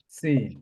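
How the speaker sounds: "সিন" with the S hissed first; audible hissing S. Shown as a demonstration. Teacher shows that sin makes a noise, "সিন" with hissed S. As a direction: lean on the whistling S of "সিন". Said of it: "একটু শব্দ হবে".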